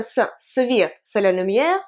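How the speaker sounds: ordinary talk, nothing marked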